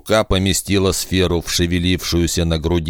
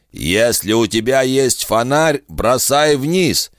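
rattling inhuman voice; 7 semitones higher than usual. A narrator's reading. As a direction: sound like snakes in the throat. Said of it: "странная дребезжащая нотка".

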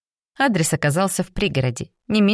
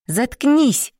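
sharp, higher angry snap; snapped shut up; 8 semitones higher than usual. A narrator's reading. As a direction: bark it at her. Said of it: "бросила ей Дженни".

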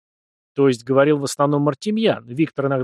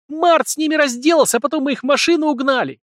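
high, loud, excited blurt; blurted excitedly; 13 semitones higher, 4 dB louder than usual. A narrator's reading. Vitka bursting to tell the girl the news: excited, high and loud. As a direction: blurt it out wide-eyed, much higher. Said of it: "выпалил, делая круглые глаза, Витька".

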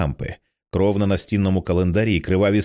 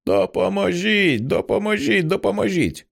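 distant cry for help; 10 semitones higher than usual. Acted. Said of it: "ледь чутний голос Джоанни".

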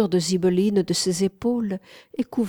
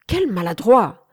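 louder, exasperated exclamation; scolding cry; 3 semitones higher, 6 dB louder than usual. A narrator's reading. Mistress scolds her clumsy slave, crying out. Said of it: "cria-t-elle".

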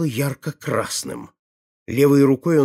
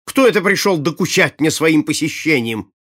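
loud, raised, irritated demand; indignant exclamation; 5 semitones higher, 7 dB louder than usual. A narrator's reading. Prince annoyed by an unwanted visitor, loud indignant question. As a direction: demand it loudly, manners forgotten. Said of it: "забыв даже о приличиях".

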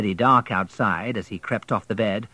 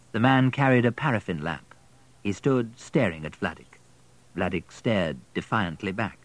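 ordinary delivery

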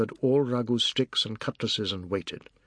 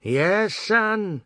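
mocking reply; 10 semitones higher, 5 dB louder than usual. Dari mocks from garden.

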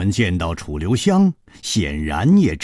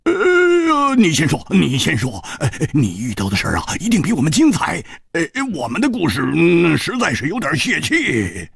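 higher, quick voice for a hearty swordsman, half-laughing; Hu Tiehua laughing; 11 semitones higher, 4 dB louder than usual. Acted. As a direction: toss the question back with a chuckle in the voice. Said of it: "胡铁花笑着说".

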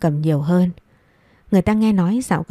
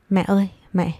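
trembling voice. Acted. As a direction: call her gently, voice trembling with dread. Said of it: "giọng run run".

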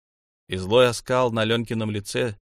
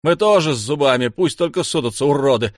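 loud, higher angry threat; furious, raised-voice bravado; defiant yelling; 4 semitones higher, 7 dB louder than usual. A narrator's reading. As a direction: yell a challenge at the attackers. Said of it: "вопит Лёнька".

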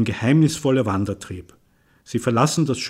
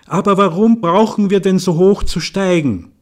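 Ella's voice; 7 semitones higher, 5 dB louder than usual. Acted. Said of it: "Ella Rentheims Stimme".

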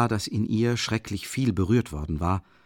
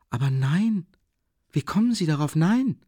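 higher, rising to an alarmed cry on the last no; startled denial; 8 semitones higher than usual. Acted. Sonja denies in alarm that she was beaten. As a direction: protest in sudden fright. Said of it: "sah ihn geradezu erschrocken an".

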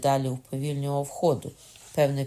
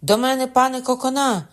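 cry for help; 10 semitones higher, 8 dB louder than usual. A narrator's reading. Calling for help.

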